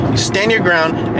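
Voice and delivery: ordinary talk, nothing marked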